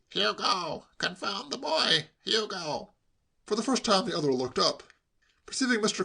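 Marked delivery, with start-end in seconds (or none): 0.1-2.9 raised-pitch call for attention; exasperated calling. A narrator's reading. Stranded uncle trying to get his nephew's attention, exasperated calling. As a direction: call out crossly without really shouting. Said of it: "cried Mister Carmody".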